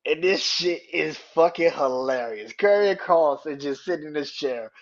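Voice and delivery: normal talking voice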